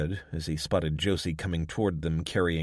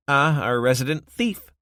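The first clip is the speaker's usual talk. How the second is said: teasing barkeep; 8 semitones higher, 8 dB louder than usual. Acted. Josie greets Wade.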